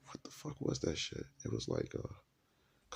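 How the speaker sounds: hushed, searching for the word; whispered aside. Real, not acted. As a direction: whisper it to yourself, puzzled. Straining to remember a word.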